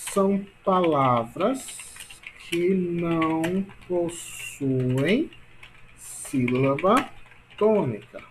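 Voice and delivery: very slow, stretched words with pauses; slow dictation. Shown as a demonstration. Teacher dictating a definition to class, slow dictation. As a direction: dictate it piece by piece, drawing out each word.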